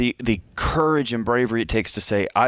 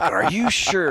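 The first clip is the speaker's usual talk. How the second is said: mock-incredulous, pitched higher; doubtful questioning; 6 semitones higher, slower than usual. Friends questioning Sheila about her man, imitated doubt. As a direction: lift it into a skeptical question.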